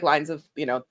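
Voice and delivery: usual speaking voice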